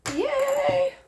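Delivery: high squeaky cheer; excited cheer. Real, not acted. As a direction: squeal it like a tiny victory. Mock celebration of a fix.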